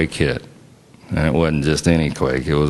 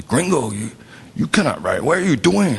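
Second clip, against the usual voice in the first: put-on high, swooping Spanish-accented doctor; 7 semitones higher than usual. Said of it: "says it in his very Spanish accent".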